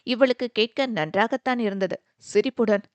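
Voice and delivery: ordinary delivery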